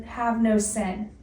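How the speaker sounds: ordinary talk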